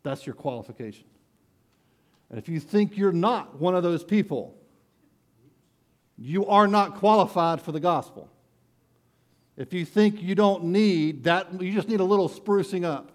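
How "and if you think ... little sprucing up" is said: louder, higher, driving the point home; full-force preaching. Acted. Preacher warning the congregation they need the gospel, full-force preaching.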